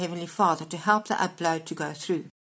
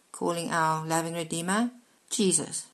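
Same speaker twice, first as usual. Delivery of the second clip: usual speaking voice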